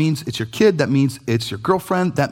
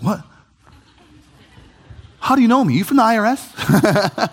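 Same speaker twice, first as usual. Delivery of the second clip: much higher, startled, with a laugh; surprised cashier; 6 semitones higher than usual. Retold or imitated. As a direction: play the puzzled cashier caught off guard. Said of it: "She's like".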